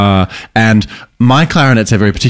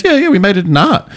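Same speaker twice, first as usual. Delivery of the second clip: put-on much higher, chirpy kid voice; student's eager answer; 11 semitones higher than usual. Teacher imitating her student describing the sock puppet, much higher. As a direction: pipe it up like a proud young pupil. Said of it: "She goes".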